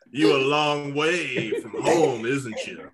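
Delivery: slow, laughing Southern drawl; mock Southern drawl. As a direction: stretch it out like a Southern tough. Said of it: "a Southern accent".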